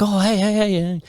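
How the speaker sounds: put-on chummy greeting; mock eager greeting. Retelling neighbours' sudden greeting.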